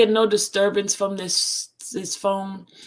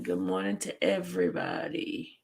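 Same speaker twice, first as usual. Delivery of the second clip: deep voice; 13 semitones lower, 7 dB quieter than usual. Pretend. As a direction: drop way down low. Deep put-on greeting voice.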